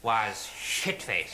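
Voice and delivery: slow séance calling, dropping low at the end; entranced medium. Acted. Medium calls to the spirits by name, trance voice. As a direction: summon a spirit with measured slowness. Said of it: "he's in a trance".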